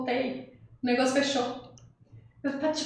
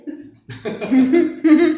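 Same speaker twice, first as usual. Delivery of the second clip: loud burst of laughter; real laugh; 3 semitones higher, 11 dB louder than usual. Real, not acted. Storyteller amused at having blamed the boy, real laughter. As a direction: laugh out loud at your own tale.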